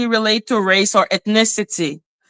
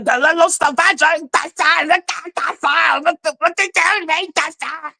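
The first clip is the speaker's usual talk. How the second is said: pitched up, self-mocking as the little savage; 7 semitones higher than usual. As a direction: play the clumsy outsider she is painted as. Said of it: "But me, as the".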